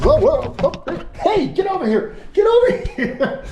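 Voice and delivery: high, laughing yelps as the lizard bolts; real surprise, voice jumping way up while chasing it; startled excitement. Real, not acted. Lizard suddenly escapes his hands.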